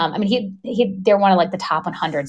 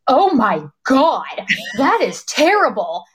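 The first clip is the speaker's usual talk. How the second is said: slow, high-pitched horrified reaction; acted shock; 7 semitones higher, slower than usual. Surgeon's assistant seeing the terrible MRI, acted shock. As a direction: draw out each word in mock horror.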